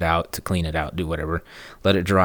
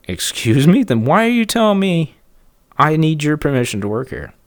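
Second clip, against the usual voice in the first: indignant; 6 semitones higher, 3 dB louder than usual. Retold or imitated. He retorts to rude neighbor.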